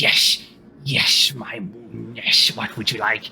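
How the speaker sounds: low, slow creature voice; grotesque servant. Acted. Grotesque Sin answers a summons, low and slow. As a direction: drag the words out low, like a grotesque statue. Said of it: "in his kind of grotesque kind of statue".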